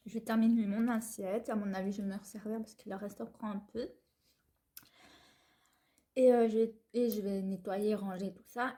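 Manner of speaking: normal talking voice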